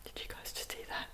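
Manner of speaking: frightened whisper. Real, not acted. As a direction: whisper it, afraid to be heard. Whispering after a noise.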